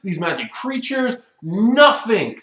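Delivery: high-pitched, indignant ranting; worked-up, frustrated complaint; exasperated rant. Real, not acted. Rant at plot hole continues.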